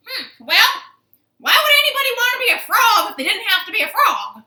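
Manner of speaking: loud, high, squawking parrot voice; shrieky parrot, fast and indignant; scoffing parrot. Acted. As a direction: squawk the question at full volume. Parrot scoffs at being a frog.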